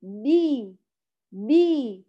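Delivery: بي voiced with a full kasra and no puff of air; correct letter sound. Shown as a demonstration. Teacher shows pupils the sound of the baa, fully voiced. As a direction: say بي fully voiced, long clear i. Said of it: "ما هو صوته".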